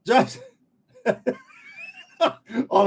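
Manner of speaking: real laugh breaking through the words. Real, not acted. Host laughing at a chat comment.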